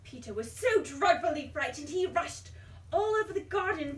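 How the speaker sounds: usual speaking voice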